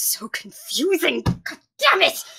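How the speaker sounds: slow build to a loud, high curse; frustrated outburst. Acted. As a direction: drag it out, then explode. Frustrated outburst during investigation.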